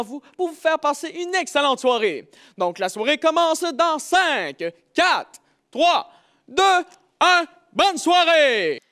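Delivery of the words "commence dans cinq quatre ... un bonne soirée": building countdown, louder each number; excited countdown. Acted. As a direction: count down to the show, rising. Countdown opening the show. (décompte enthousiaste)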